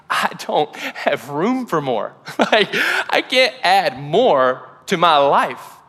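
slow, strained protest of someone overloaded; acted overwhelm. Acts out an overburdened listener.

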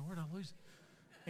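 a confused dad wondering aloud; bumbling guy searching, slowed right down; goofy lost guy. Retold or imitated. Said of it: "how goofy guys are when they lose something".

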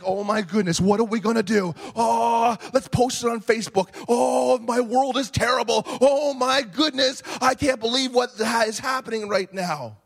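hammed-up fretful wailing; mock panic. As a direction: dramatize overblown dismay for the congregation. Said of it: "the first thing we do is we fear".

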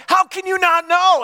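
high, loud, exasperated demand; upset and angry. Retold or imitated. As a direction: accuse her, incredulous and upset. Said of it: "And I'm all upset with her".

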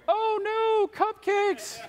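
very high mock-horrified squeal; hammed-up villainous dismay; powers of evil panicking. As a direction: cry out in exaggerated, high-pitched dread. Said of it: "hear the powers and principalities of evil going".

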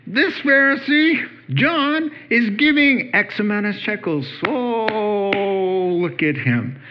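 hammed-up town crier; mock herald announcement. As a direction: announce the donor like a pompous herald. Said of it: "and announce".

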